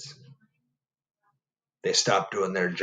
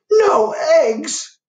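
much higher, loud disbelieving shout; shocked cry; 8 dB louder than usual. Acted. Farmer Brown shouting on learning the hens quit. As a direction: yell it in disbelief. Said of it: "cried Farmer Brown".

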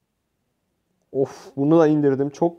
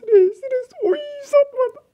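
high, crying wail of panic; panicked wail; 4 dB louder, slower than usual. Real, not acted. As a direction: wail as if about to die. Panicked gamer under attack. (panik içinde ağlamaklı feryat)

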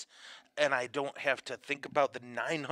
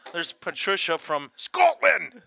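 Scottish accent; 5 semitones higher, 9 dB louder, slower than usual. Shown as a demonstration. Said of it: "You gotta say it like that".

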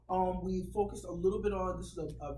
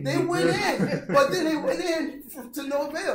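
laughing; 9 semitones higher, 12 dB louder than usual. Real, not acted. Laughing through his point.

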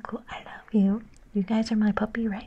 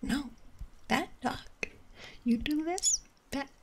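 mock scolding owner; 4 semitones higher, 5 dB quieter than usual. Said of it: "at the end they go".

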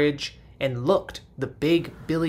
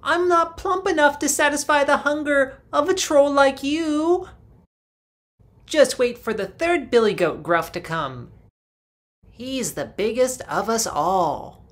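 much higher, squeaky goat voice pleading; a nervous middle goat begging the troll; second billy goat; 11 semitones higher, 7 dB louder than usual. Acted.

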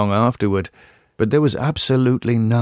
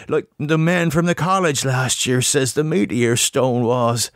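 higher rustic country voice, urgent and fearful; an old New England farmer warning of horror, pitched up; frightened old farmer Ammy; 6 semitones higher than usual. Acted. Narrator voices old Ammy's warning.

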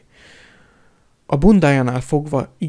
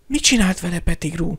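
ordinary delivery

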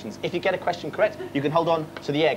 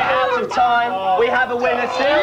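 louder, slow announcement; 4 semitones higher, 4 dB louder, slower than usual. Acted. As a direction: call time like a game host. Host announces end of round.

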